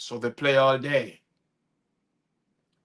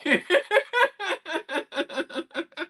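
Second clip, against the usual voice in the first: high burst of laughter. Real, not acted. Poet laughs at his own blooper, high squeal. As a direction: break into a high cackle.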